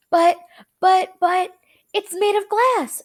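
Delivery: high, loud stammering panic; panicked shout. A narrator's reading. Grandpa Joe panics that the glass will shatter, high and loud. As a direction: sputter in fright. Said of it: "shouted Grandpa Joe".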